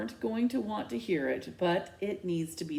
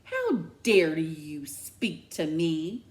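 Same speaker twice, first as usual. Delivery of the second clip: slow, louder, trembling outrage; furious disbelief; outraged; 4 dB louder, slower than usual. Acted. Mom outraged at the bully addressing her. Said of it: "too much for her".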